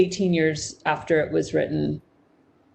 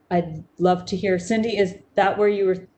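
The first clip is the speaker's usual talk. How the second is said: ordinary talk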